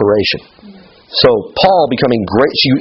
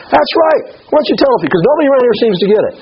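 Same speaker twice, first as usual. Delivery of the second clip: much higher, fast, teasing sarcasm; sarcastic reply; 12 semitones higher, faster than usual. Retold or imitated. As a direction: toss it back with playful sarcasm. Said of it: "what is Jesus' response".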